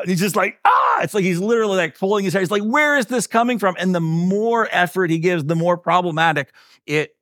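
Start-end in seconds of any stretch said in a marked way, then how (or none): 0.6-1.0 high-pitched exasperated "ah"; short comic yell, voice shooting up; mock freak-out yell. Prank victim freaking out in the shower, mock frustrated yell. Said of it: "he just starts freaking out". 2.6-3.8 high-pitched mock exasperation; freaking out. Prank victim baffled by endless shampoo, mock freak-out. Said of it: "he just starts freaking out".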